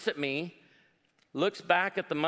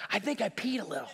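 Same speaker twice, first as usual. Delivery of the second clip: put-on a softer, confiding older-lady voice; 5 dB quieter, faster than usual. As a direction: let it slip out quietly like a sheepish old lady.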